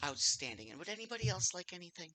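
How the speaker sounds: soft, quick barmaid voice; kindly older lady. Acted. Barmaid asking the rest for orders, kindly older lady. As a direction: gently check on the other guests.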